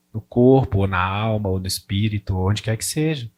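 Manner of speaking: súplica baixa e sincera, quase murmurada; oração. Shown as a demonstration. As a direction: falar com o Pai de coração aberto. Prayer voiced as example. (oração íntima e contida)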